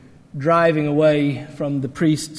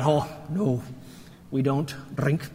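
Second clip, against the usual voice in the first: bad Scottish accent; 3 semitones lower, 8 dB quieter than usual. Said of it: "Scottish accent ... never comes out right".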